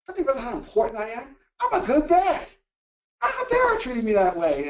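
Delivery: mock-indignant, pitch climbing high on I'm a good dad; indignant self-pity. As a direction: play the wounded, self-important father, overdone. Mocking an entitled, self-important self.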